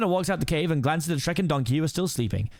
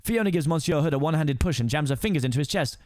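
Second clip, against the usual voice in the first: plain reading voice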